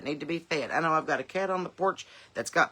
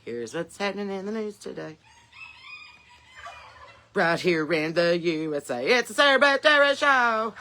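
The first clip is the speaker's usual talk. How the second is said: news jingle announcer; 3 semitones higher, 4 dB louder than usual. Pretend. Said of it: "Be like".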